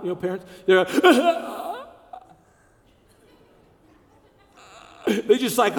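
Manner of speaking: ordinary talk, nothing marked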